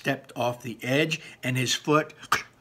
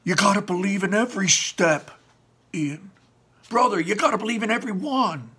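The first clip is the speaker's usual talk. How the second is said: higher, louder, urgent encouragement; shouting encouragement; 10 semitones higher, 6 dB louder, faster than usual. Acted. Barley shouting encouragement to Ian, higher and louder. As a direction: shout it to push him on. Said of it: "and then he shouted".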